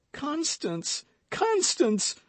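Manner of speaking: very high, rising anguished call; anguished cry. Acted. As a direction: cry her name in despair. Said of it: "cried D'Artagnan".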